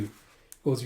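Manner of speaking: ordinary delivery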